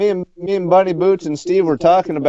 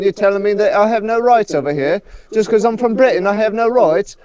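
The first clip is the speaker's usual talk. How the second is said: mock British accent; 3 semitones higher, faster than usual. Plays Brit tourist.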